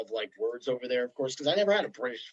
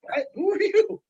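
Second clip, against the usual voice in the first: high, loud baffled what; mock bafflement; 4 dB louder than usual. Longtime writer confronting newcomers, high and baffled. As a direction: sound thrown by the stranger. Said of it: "And you're like".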